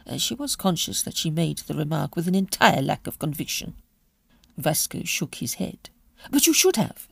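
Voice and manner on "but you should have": sharp, raised reproach; angry. A narrator's reading. Aunt angrily scolding her nephew, sharp and raised. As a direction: scold him for keeping the papers. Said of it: "said Lulu angrily".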